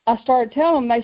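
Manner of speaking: ordinary delivery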